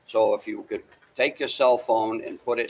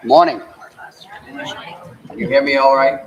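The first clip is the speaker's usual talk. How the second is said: louder, filling the hall without a mic; loud projecting voice; 4 semitones higher, 9 dB louder than usual. Real, not acted. To the townspeople at the meeting, projecting loudly. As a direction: call out so the back rows hear. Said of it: "I got a fairly loud voice".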